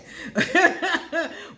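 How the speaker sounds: big high burst of laughter; loud laugh. Real, not acted. Laughs at her own reply.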